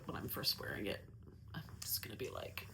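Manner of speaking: soft unvoiced whisper. Real, not acted. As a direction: murmur it to yourself, barely voiced. Whispering aside about the future.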